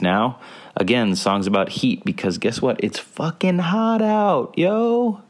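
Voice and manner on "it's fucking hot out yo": much higher, slow, squeaky exclamation. Real, not acted. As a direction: squeal it out in a high pitch. Exclaiming about the heat.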